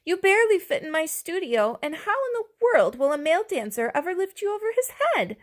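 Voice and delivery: high voiced, fast lament; teacher regretfully telling the dinosaur, high voiced; teacher's lament. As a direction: fret in a high voice.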